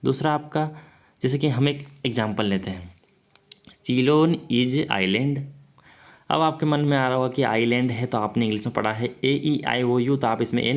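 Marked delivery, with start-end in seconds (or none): 3.8-5.4 each word set apart slowly for the class; slow dictation. Shown as a demonstration. Teacher reading the example sentence to the class, slow and deliberate. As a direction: read the example out slowly so students can follow.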